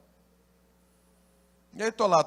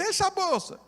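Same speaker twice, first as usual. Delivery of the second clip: much higher, frantic wife's cry; panicked plea; 4 dB louder than usual. Retold or imitated. Terrified wife begging her husband to give up the bag. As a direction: squeal it out in terror.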